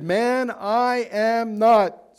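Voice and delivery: insistent, drawn-out "not"; emphatic denial. Acted. Reads Peter's denial aloud.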